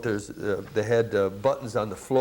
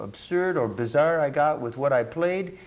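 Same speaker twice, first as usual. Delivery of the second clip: normal talking voice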